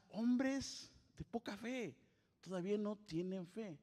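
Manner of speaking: slow rebuke. Acted. Jesus rebuking disciples.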